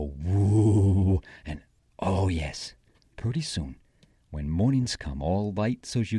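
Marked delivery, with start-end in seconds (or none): 0.0-1.2 loud, long "woo" of a mad bear; mad bear growl. Acted. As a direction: imitate an angry old bear's howling growl. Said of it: "he's mad".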